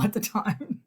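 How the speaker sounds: ordinary talk, nothing marked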